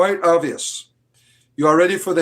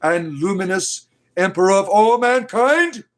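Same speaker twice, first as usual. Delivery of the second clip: fervent preaching; 6 semitones higher than usual. Acted. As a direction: preach like a fanatic priest. Fanatic preaching of the Emperor.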